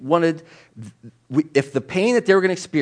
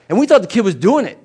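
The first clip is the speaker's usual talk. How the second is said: usual speaking voice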